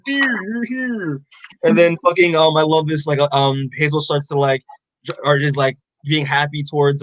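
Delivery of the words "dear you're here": high, drawn-out cooing welcome; retold fond greeting. She greets the arrivals who appear by magic, drawn-out mock greeting. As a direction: stretch it out in a higher put-on voice. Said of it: "And then she's like".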